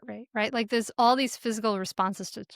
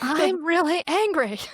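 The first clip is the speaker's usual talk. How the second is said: higher-pitched, toned-down replay of yelling; retold angry yell; 7 semitones higher, 4 dB louder than usual. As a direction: re-enact the yell at partial force. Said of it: "I yelled".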